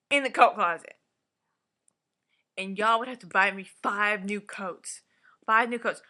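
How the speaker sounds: loud, heated tirade about the coats; really wound up, let it build; worked-up rant. Real, not acted. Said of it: "Let me calm down".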